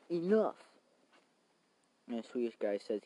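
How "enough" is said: sharp, high, loud shout; loud high cry of enough. Retold or imitated. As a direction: bark it out to end the standoff. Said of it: "He says".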